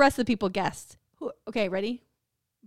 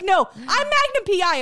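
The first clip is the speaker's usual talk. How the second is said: mock indignant; 7 semitones higher, 5 dB louder than usual. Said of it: "I was so mad".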